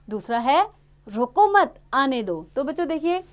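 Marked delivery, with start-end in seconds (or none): 1.1-2.4 loud, slow रोको मत together, then आने दो; group रोको with मत, break before आने दो; pause after मत. Shown as a demonstration. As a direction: comma break straight after मत. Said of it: "यहाँ पे मत के बाद लगा है".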